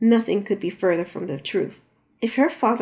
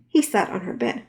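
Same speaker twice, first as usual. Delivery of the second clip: plain reading voice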